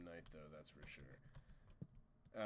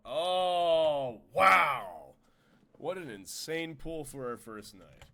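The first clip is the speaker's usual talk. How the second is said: excited; 4 semitones higher, 13 dB louder, much slower than usual. Real, not acted. Finds an amazing cave pool.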